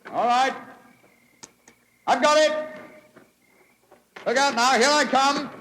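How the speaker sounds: high, loud calls while climbing; calling up. Acted. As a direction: shout up as you climb the rope.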